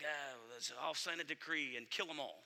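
very low, very soft offhand royal reply; king giving in. Retold or imitated. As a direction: agree in a deep, barely audible murmur. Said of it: "And he's like".

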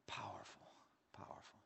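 breathless hushed whisper; a reverent whisper to the congregation. Real, not acted. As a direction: breathe the word out in awe. Preacher hushes after the cross.

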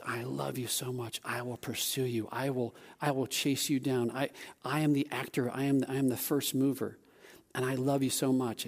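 low, soft, earnest declaration; tender assurance. Acted. Jesus to those who rejected him, tender assurance. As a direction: speak love gently and steadily, pitched low. Preacher voices Christ's pursuing love.

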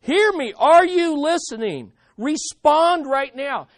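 loud, high cry for an answer; insistent, pleading shout; urgent cry. Acted. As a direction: call out urgently to God. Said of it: "Can you hear the urgency".

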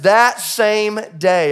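"That same day" drawn out slowly, each word weighted; slow emphasis. Shown as a demonstration. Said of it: "say that out loud with me".

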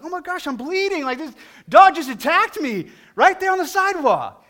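high, loud, panicked gasp of discovery; alarmed shock. Retold or imitated. To his friend on spotting the bleeding bite, high alarmed shock. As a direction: yelp it like you just saw blood.

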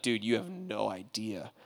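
man quietly defending Samaritans to a critic; hushed whisper. Retold or imitated. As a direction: confide it with reverence. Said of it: "he'd be like".

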